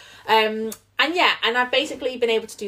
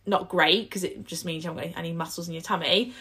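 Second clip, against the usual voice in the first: ordinary talk, nothing marked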